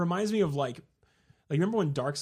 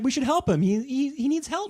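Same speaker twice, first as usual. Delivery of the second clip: put-on high, louder begging child; kid's plea; 12 semitones higher, 4 dB louder than usual. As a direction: imitate a boy pleading with Dad. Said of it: "and it's like".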